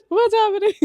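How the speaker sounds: laughing through the quote; laughing echo. Real, not acted. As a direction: repeat it while cracking up. Repeats partner's quote laughing.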